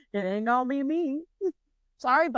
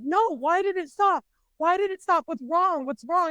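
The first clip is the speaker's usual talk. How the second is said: high, urgent repeated questions; acted-out panic; 6 semitones higher than usual. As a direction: fire off alarmed questions, pitched up. Retold panic as ride stops.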